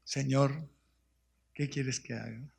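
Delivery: very soft, humble prayer; humble plea. Acted. Believer asks the Lord, humbly softly. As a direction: ask it humbly.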